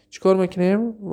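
ordinary talk

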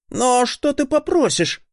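put-on high, slow, wary boy; timid boy voice. A narrator's reading. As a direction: ask it slowly, unsure. Scared boy asks.

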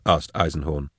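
ordinary delivery

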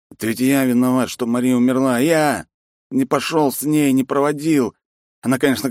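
put-on drunk grief. Drunk man blames himself.

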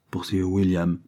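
ordinary delivery

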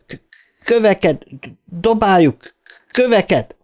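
high, urgent stammered cries; stammer, then call out each word as an order; stuttered shouting. A narrator's reading. Bill rallies the others against Henry, stuttered shouts. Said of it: "kiabálni kezdett".

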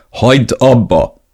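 stern slow snap; a firm, slow stop. A narrator's reading. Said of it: "kicsit hangosabban mondtam ezt".

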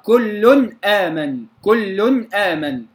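كل آمن slowly, crisp tanween noon then clear hamza; Hafs izhar. Shown as a demonstration. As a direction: keep kullun and aaman distinct in كل آمن. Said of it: "في رواية حفص". (إظهار حفص في كل آمن)

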